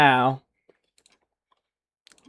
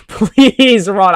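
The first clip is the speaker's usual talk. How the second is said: laughing; 7 semitones higher, 7 dB louder than usual. Real, not acted. Laughing, urging the suspect to run.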